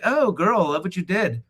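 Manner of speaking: high, gushy mock compliment; playfully imitated girl-to-girl praise; gushing girlfriend. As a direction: coo like an approving girlfriend. Voices women complimenting each other.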